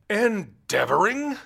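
high, slow roar of outrage; roaring tyrant king. Acted. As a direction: roar the word back at him. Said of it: "the king roared".

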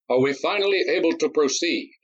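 puzzled and alarmed; exasperated senator. A narrator's reading. The senator pushing the duel on, exasperated. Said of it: "the perspiring senator asked".

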